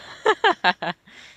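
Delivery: loud shrieking cackle; high squealing laugh. Real, not acted. Cracking up at crone joke.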